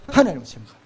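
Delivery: ordinary talk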